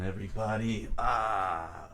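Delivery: usual speaking voice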